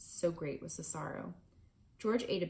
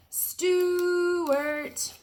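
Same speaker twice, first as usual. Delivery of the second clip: calling out; 12 semitones higher, 11 dB louder than usual. Acted. Family calls down mouse hole.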